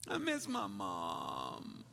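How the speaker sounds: slow, drawn-out imitation of a tearful kid; mock crying child. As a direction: wail it softly, stretching the words. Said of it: "she just starts crying and says".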